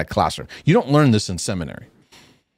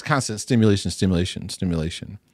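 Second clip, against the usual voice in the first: each stimulation softer and lower, trailing off; fading repetition; 3 semitones lower, 4 dB quieter than usual. Shown as a demonstration. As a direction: let the repeated word fade away. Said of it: "repeating word fading".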